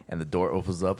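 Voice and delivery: usual speaking voice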